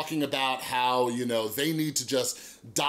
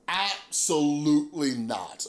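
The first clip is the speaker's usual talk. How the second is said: ABSOLUTELY NOT drawn out slowly; emphatic refusal; slower than usual. Retold or imitated. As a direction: stretch out "Absolutely not", syllable by syllable. Retelling his firm refusal.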